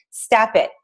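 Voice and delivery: ordinary talk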